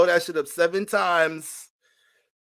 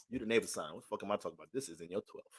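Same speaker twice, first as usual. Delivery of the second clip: muttering to self; 6 semitones lower, 14 dB quieter, faster than usual. Real, not acted. Muttering correction to himself.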